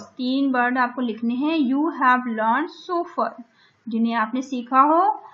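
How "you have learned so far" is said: slow task reading. Shown as a demonstration. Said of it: "teacher reading the task".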